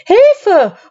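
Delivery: high, loud alarmed shout; startled shout for help; cry for help. A narrator's reading. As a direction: yell it as the bridge tips. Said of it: "rufen die Wallabier".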